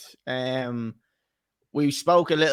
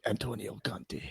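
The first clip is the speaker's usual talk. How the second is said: low, soft put-on Italian accent; Antonio Conte accent; 5 semitones lower, 12 dB quieter than usual. Shown as a demonstration. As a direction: say the name quietly in a mock Italian accent. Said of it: "can you just do the accent?".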